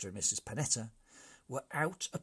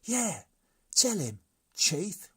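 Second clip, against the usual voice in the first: high, loud whining protest; a chorus of brigands objecting loudly; 8 semitones higher, 8 dB louder, slower than usual. A narrator's reading. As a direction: complain together, shrill and loud. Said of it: "cried the brigands".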